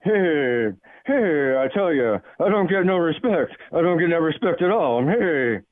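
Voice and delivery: very low Rodney-style comic complainer; Cal in Vegas impersonation. Acted. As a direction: drop the pitch way down for a put-upon comedian's shtick. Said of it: "updated my Cal in Vegas impersonation".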